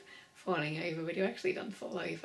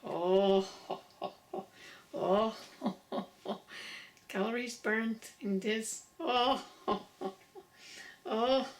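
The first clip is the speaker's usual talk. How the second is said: oh vocal; much slower than usual. Shown as a demonstration. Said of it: "Go with an O".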